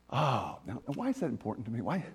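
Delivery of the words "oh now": usual speaking voice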